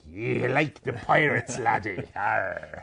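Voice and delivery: slow, hammy pirate drawl; playful pirate talk ending in a long arr. Pretend. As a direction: tease the co-host as a pirate. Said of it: "I like him a little more with the pirate voice".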